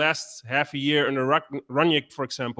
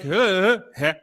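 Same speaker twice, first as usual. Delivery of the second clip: Dutch hè imitation; 2 semitones higher, 4 dB louder than usual. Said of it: "It's like".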